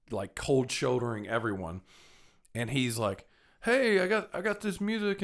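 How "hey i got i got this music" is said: a much higher mock call to passersby; imitated street hustler. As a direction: pitch your CDs to people walking past. Street seller calling out.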